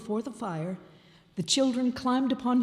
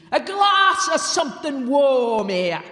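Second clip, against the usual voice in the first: loud, higher goblin king shout; king's command; 4 semitones higher, 7 dB louder than usual. A narrator's reading. Goblin King calling to his goblins, loud shout. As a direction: bark the order to the courtiers. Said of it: "said the king".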